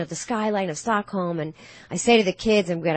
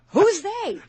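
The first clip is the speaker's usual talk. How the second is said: mock New York fan; 11 semitones higher, 4 dB louder than usual. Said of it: "She's the first person ever".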